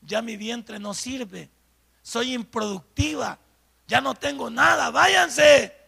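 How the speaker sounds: slow, drawn-out irony swelling into a shouted dismissal; acted ironic self-dismissal, building slowly to a loud send-off; ironic protest. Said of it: "yo irónicamente les digo".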